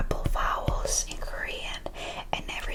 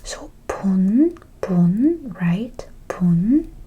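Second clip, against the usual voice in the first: pun whispered loudly and punched; stressed syllable; 10 dB louder, much slower than usual. Shown as a demonstration. Teacher drilling the syllable pun for students. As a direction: hit pun hard each time. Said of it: "omitting to read this".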